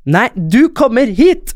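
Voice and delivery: high, drawn-out insistent call; retold shouted order. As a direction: imitate an insistent conductor calling out. Shouting match retold with conductor.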